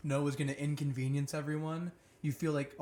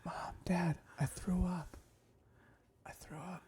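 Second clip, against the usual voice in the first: unvoiced whisper, softer and softer; creepy whisper; 2 semitones higher, 6 dB quieter, slower than usual. Child tiptoeing to wake mom and dad, creepy whisper. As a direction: whisper it eerily at the bedside. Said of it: "You did it all creepy".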